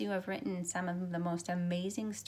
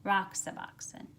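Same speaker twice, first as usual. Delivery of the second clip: put-on lively, expressive read-aloud for children; storybook reading; 4 dB louder than usual. A narrator's reading. Teacher reads picture book aloud.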